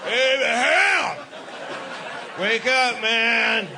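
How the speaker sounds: God calling out, high and drawn out; booming God voice. Retold or imitated. God waking Abraham, calling out high and slow. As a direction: bellow his name slowly from above. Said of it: "He's like".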